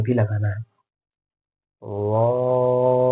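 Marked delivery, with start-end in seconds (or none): 1.8-3.1 slow, drawn-out Arabic letter; rolled letter demo. Shown as a demonstration. Teacher drawing the letter out slowly for learners. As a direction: stretch the letter as a model for students. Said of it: "ज़बान को रोल भी करना है".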